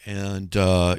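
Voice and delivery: ordinary talk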